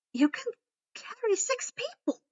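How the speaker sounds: rising high in awe; awed. A narrator's reading. Sinistra asking the girl in awe, high and amazed. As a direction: marvel at what she can do. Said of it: "with a bit of awe in her voice".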